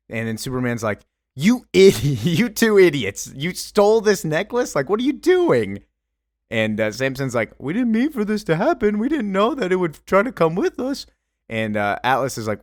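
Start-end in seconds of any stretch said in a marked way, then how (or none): 1.4-5.8 high-pitched, outraged telling-off; exasperated scolding. Acted. Superman to the two necklace thieves, exasperated scolding. 7.6-11.1 high-pitched, defensive protest; pleading excuse. Acted. Samson to angry Superman after the theft, pleading excuse.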